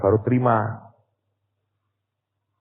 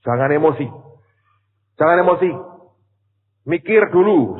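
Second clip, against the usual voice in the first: louder, higher pleading caution; urgent warning; 4 semitones higher, 5 dB louder than usual. Retold or imitated. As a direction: warn him firmly, raise your voice. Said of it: "Saya berkata gini". (peringatan tegas dan lantang)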